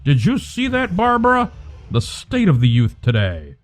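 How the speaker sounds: put-on fussy, prim old-timer grumbling; a scandalized elderly passerby voice; offended old bystander. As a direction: sniff at the rude youth.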